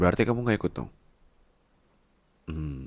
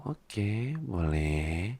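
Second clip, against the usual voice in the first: normal talking voice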